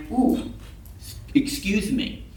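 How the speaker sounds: high-pitched ooh, then a hushed excuse me; mock apology. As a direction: back off with exaggerated, softened politeness. Woman backing off, mock apology.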